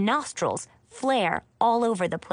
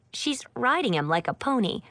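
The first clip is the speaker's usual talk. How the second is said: usual speaking voice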